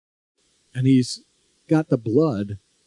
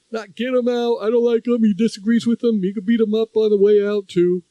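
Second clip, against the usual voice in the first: higher, mocking bully voice throughout; mock bully taunt; 8 semitones higher than usual. Host mocking the president's rally talk, higher sneering bully voice.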